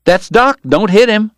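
high, urgent plea to stop; urgent warning. A narrator's reading. Morg stopping Wyatt from punching Doc, urgent.

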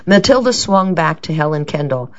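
ordinary delivery

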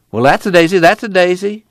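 grandma crowing over the same phrase; quoted grandma. Retold or imitated. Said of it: "his grandma standing there says".

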